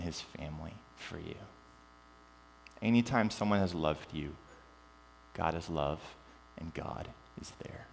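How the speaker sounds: soft, low, gentle assurance; tender reassurance. Real, not acted. Pastor assuring the congregation God is with them, tender reassurance.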